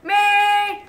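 high, loud shout of me; every kid volunteering at once; eager shout. Retold or imitated. Nerds all volunteering, high excited yell.

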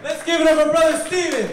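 high, loud hype call; rousing cheer. Real, not acted. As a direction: whip up applause for the volunteer. Hyping applause for reader.

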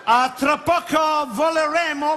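put-on high, slow English-accented Italian; slow English-accented announcement. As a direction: speak like an English flight attendant. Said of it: "inglese sempre".

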